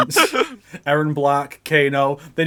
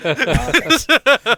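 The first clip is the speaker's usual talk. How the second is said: high burst of laughter; loud high laughter; 7 semitones higher, 6 dB louder than usual. Real, not acted.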